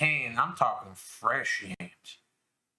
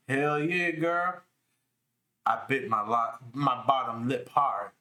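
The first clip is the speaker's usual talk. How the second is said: ordinary talk, nothing marked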